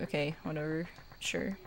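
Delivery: softly muttered, grudging compliance; dismissive. Retold or imitated. Streamer's imagined comeback to aunt, muttered and dismissive. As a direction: give in under your breath. Mock reply to scolding aunt.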